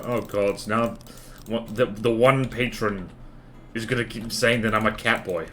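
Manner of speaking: ordinary talk, nothing marked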